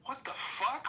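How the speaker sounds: high-pitched baffled outburst; jolted awake and totally bewildered; startled disbelief. Retold or imitated. Husband woken by his wife's insult, startled. Startled waking reaction, retold.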